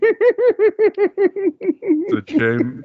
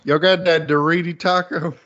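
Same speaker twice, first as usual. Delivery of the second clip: high, loud southern voice; southern accent; 6 semitones higher, 4 dB louder than usual. Retold or imitated. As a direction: ask for tacos with a southern twang. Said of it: "southern accent".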